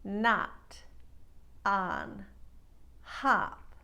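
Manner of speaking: not, on, hop with a wide nasal American vowel; American regional not, on, hop. Shown as a demonstration. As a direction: let the vowel spread flat and nasal. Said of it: "words here with regionalisms would sound like".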